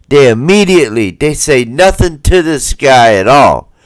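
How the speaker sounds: usual speaking voice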